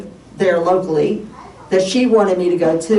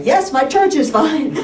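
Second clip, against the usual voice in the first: higher-pitched, fed-up retort; exasperated reply; 8 semitones higher than usual. Retold or imitated. Patient answering doctor's question about church, exasperated. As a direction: act out losing patience with the questions.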